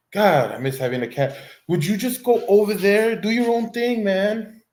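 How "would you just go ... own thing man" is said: higher, whining plea to the dog; whiny plea. Real, not acted. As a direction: beg the dog to leave you be. Pleading with clingy dog.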